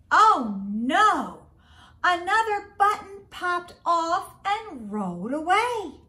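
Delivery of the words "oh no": ordinary delivery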